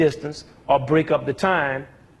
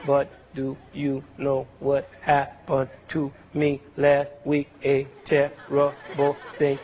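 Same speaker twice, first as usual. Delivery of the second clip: slow, lower, unbroken monotone with no accents; run-on speech with no rests; 3 semitones lower, slower than usual. Shown as a demonstration. Teacher to class, showing speech with no rests: slow even monotone. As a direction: say it all in one breath with no pauses or stress. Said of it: "what if we spoke like".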